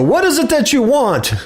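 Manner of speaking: high, mock-solemn master's voice; mock ritual question. Worshipful master to blindfolded candidate, high mock-solemn. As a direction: raise the voice into a lodge master's question.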